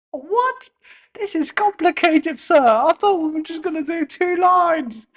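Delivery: high, loud squealing protest; hammed-up pupil's panic, pitched far up; panicked pupil. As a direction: wail it like an aggrieved schoolkid.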